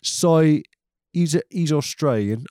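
put-on a failed try at an Australian accent; half-hearted Australian accent; bad Australian accent. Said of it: "I think I can't do accents anymore".

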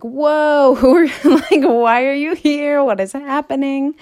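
high, loud startled yelp with a laugh. Retold or imitated. Issa startled to find her ex there, high and loud. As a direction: jump out of your skin, then laugh. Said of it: "And she's like".

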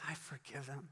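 very soft, almost whispered; barely voiced, tentative echo; hushed repeated prayer. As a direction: repeat it under your breath.

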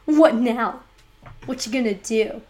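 loud, playful trash talk in a put-on voice; mock taunt. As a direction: taunt like a cocky rival. Mock taunt back for Wyoming.

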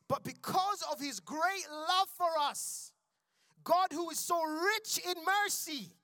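slow, high and loud, because of his great love; slow proclamation. Real, not acted. Preacher proclaiming the verse slowly, high and loud. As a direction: lift each word up slowly. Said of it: "now I wanna read these very quickly".

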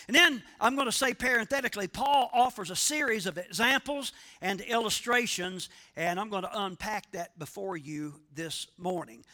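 ordinary talk, nothing marked

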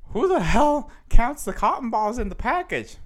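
high, loud mock disbelief; incredulous. Store staff reacting to a cotton ball complaint, incredulous. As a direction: exclaim it like a baffled store clerk.

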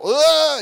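high, loud startled scream; mock terrified cry; scared yelp. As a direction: shriek at a ghost on the water. Disciples see Jesus on water.

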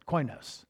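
koinos said softly as KOY-noss; pronunciation demo. Shown as a demonstration. Preacher showing the congregation how to say koinos, softly. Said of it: "That's how we would pronounce it".

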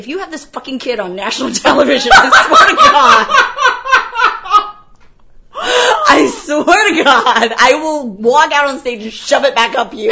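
loud, high, laughing tirade; exasperated threat. Retold or imitated. Producer threatening performer in labor, comic exasperation.

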